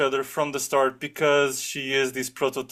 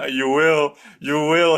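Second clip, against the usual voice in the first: louder, insisting you will; insistent mock-romantic; 6 dB louder, slower than usual. Retelling the tutor's courtship line, louder insistence. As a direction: press the line like a smitten suitor, louder. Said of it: "He starts saying".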